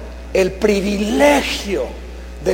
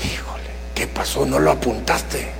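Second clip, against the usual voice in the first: soft, whispered, much lower; hushed fatherly whisper; 10 semitones lower, 9 dB quieter than usual. Retold or imitated. Father quietly asks his son on the phone. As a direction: gently ask the son what happened.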